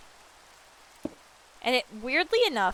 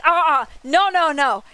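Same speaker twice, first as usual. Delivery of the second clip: louder, higher flat-out no; retold loud refusal; 6 semitones higher, 9 dB louder than usual. Streamer retelling her reaction to the square-building ban, loud refusal. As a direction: refuse it loudly, half-laughing in disbelief. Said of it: "I was like".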